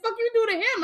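high, loud retold challenge; mock incredulous question. Friend's mock interrogation retold.

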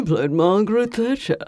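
over-the-top British stateswoman impression; Margaret Thatcher. Podcaster mimicking an actress's portrayal, overdone British. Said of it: "over-the-top impression of Margaret Thatcher".

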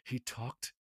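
low, very quiet, halting mimicry; imitating an actor's odd delivery. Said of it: "like this".

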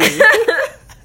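high, loud burst of laughter. Real, not acted. Laughs again.

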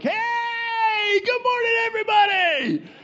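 very high, drawn-out holler; yelled greeting. Retold or imitated. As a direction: bust in whooping an over-the-top hello. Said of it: "just yelling and pumping my fist".

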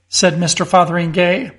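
ordinary delivery, nothing marked

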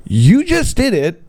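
normal talking voice

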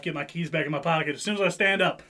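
ordinary talk

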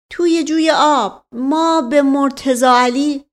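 high, loud defensive answer; pleading, drawn-out oath of innocence; frightened servant. Acted. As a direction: protest anxiously under interrogation.

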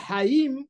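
HAIM opened with a breathy throat h; het sound. Shown as a demonstration. Teacher giving an example of het, throaty h. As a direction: stress the het starting "Haim". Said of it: "Haim es con het".

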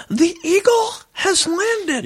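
very high, slow falsetto. Shown as a demonstration. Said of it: "I've done a lot of falsetto".

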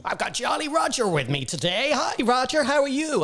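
high, bright presenter greeting; imitating radio presenter. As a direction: mimic a cheesy radio host. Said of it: "he went".

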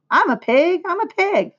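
put-on high, gleeful boy's chant; hammed-up kid yelling in play. A narrator's reading.

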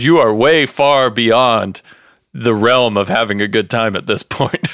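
normal talking voice

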